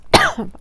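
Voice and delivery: loud, sharp cough; interrupted by a hard cough; real coughing. Real, not acted. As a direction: cough to clear a blocked throat. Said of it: "je tousse dans le micro".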